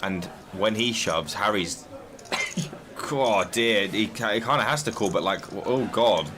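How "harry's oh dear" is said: ordinary talk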